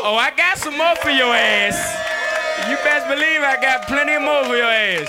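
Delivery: loud playful shout back to the crowd. Real, not acted. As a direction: holler it at the fans. Answering crowd chant.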